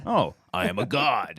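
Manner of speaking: slow, deep god-king boast; megalomaniac rant. Retold or imitated. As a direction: proclaim your divinity slowly. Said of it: "Does he sound like that?".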